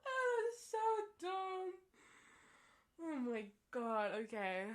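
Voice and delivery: laughing. Real, not acted. Still laughing after the joke.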